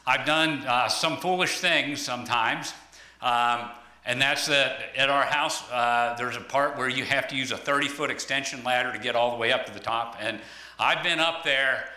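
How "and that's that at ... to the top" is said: ordinary talk, nothing marked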